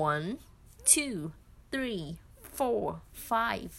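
one two three four five counted with native English sounds, crisp; native English accent. Shown as a demonstration. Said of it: "ให้ได้สำเนียงเขาหน่อย แบบ". (นับ one two three four five ด้วยสำเนียงเจ้าของภาษา)

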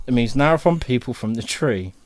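ordinary talk, nothing marked

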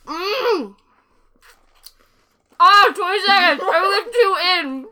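laughing through a mouthful of crackers; mouth full, laughing. Real, not acted. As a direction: choke out words past dry saltines.

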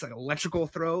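ordinary delivery

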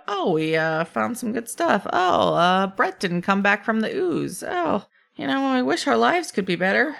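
a hint of a weary kobold sighing by the fire; voicing kobolds chatting. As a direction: mope about your day as a cave creature. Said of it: "Just like".

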